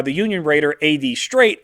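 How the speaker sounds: ordinary delivery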